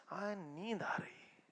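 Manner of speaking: drowsy student whispering after stuffing himself; sleepy whisper. Pretend. Student after a heavy Sunday meal, sleepy whisper. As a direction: breathe it out, half asleep.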